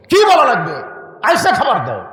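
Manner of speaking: a sharp wife answering her husband; wife snapping. Retold or imitated. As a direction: bark the order back.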